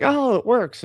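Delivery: high-pitched pretend amazement; mock delight. Imagined eight-ball user getting a right answer, mock delight. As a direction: act thrilled that it came true.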